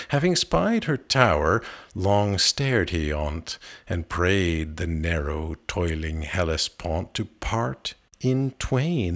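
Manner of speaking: ordinary delivery, nothing marked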